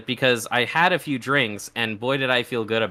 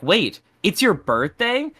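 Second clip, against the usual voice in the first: raised pitch, slow questioning; retold surprise; 6 semitones higher, slower than usual. Acquaintance hearing of birthday, mock surprised. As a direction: act out someone just catching on.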